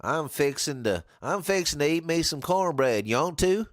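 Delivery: a mock Southern twang on fixing to; southern accent. Shown as a demonstration. Streamer demos Southern slang to a learner in mock Southern accent. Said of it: "the southern slang of things".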